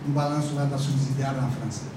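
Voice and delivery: usual speaking voice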